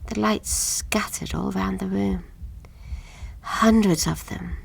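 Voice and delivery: slow, unvoiced, hushed; awed whisper. A narrator's reading. Narrator describing the lights bursting out, hushed slow whisper. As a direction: whisper it as if you can't believe it.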